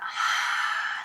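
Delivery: breathy ocean-like exhale through constricted throat; ujjayi breath. Shown as a demonstration. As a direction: hiss the breath out from the back of the throat. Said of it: "to make that".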